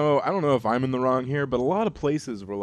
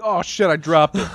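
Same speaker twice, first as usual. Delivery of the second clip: mock dismay; 8 semitones higher, 11 dB louder than usual. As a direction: pretend startled, louder. Pretend drop of the console.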